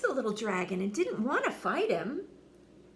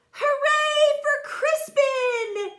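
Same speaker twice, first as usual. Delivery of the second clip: very high, loud, slow cheer; cheering shout; 8 dB louder, slower than usual. A narrator's reading. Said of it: "everyone shouted".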